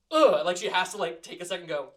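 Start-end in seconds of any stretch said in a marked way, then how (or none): 0.1-0.5 higher, loud effort grunt; straining grunt. Acted. Caretaker shoving the door again, loud grunt.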